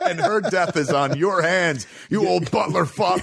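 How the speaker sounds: high, laughing mock rage; laughing accusation at the butler; mock furious Bruce. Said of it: "you have the argument to Alfred".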